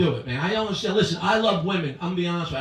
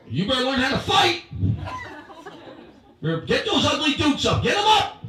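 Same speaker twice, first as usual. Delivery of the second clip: mock coach building to shout; 8 semitones higher, 4 dB louder than usual. Parent coaching ugly kid.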